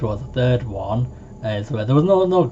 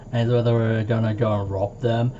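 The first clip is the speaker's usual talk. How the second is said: ordinary talk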